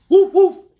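very high, loud barking whoops; dog bark. Acted. Dog calling to the spider, loud high barks. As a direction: bark it out like an excited puppy. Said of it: "said the dog".

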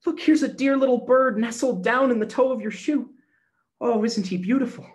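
put-on high, loud delighted child; excited little girl. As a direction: show off the bird with joy. Excited child shows the bird.

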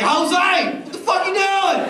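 high, loud shout at a worker; mock boss yelling. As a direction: yell like an angry boss, hammed-up. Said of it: "Sounds like".

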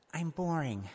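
high whiny little voice; the story sulks back; sulking story. Pretend. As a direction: pout it out like a sad kid.

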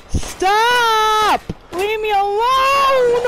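high, loud crying shout; terrified shout. Real, not acted. Gamer screaming at the monster attacking, in terror. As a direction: beg it to stop, screaming.

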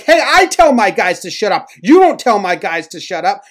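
loud, high mock shouting match; retold angry Yost. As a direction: imitate a manager yelling back, indignant. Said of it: "Yost is like".

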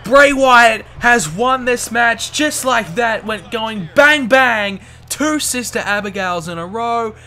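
excited, loud play-by-play shouting; wrestling commentator. Acted. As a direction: call the finish like a ringside announcer. Live match play-by-play.

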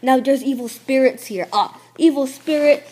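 normal talking voice

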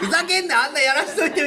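high, loud joking outrage; mock angry heckle. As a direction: play a fed-up audience member, laughing. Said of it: "本気でヤジが飛んでくるときは". (観客のヤジを真似て、笑いまじりの高い怒鳴り声)

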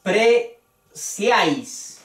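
"apreciáis" lento, partido en sílabas; sílaba por sílaba. Shown as a demonstration. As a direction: say "apreciáis" slowly, a-pre-ciáis, with "ciáis" as one syllable. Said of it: "esto forma una sola sílaba". ("apreciáis" despacio, separando a-pre-ciáis)

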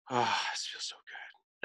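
slow breathy whisper of pure relief; hushed and slow, lying by the roots; blissful whisper. Real, not acted. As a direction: sigh the words out, melting. Blissful relaxed moment in nature.

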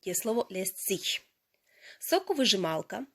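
ordinary delivery

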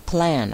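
"Plan" said alone, clearly, falling; pronunciation demo. Shown as a demonstration. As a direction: model "Plan" with its open short a. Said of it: "ครูจะออกเสียง".

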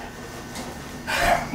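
soft unvoiced snicker; quiet madman chuckle; breathy gloating laugh. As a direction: laugh at your own cunning. Laughs at his cleverness.

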